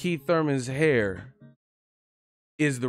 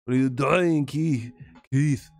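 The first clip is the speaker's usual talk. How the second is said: a light Austrian Arnold voice, a bit louder; hammed-up Arnold yelling at the boxer; Arnold Schwarzenegger impression; 4 dB louder than usual. Streamer imitating Arnold yelling at Keith, lightly done Austrian accent. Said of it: "do them Arnold Schwarzenegger".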